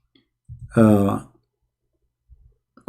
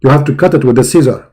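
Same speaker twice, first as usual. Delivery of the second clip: ordinary delivery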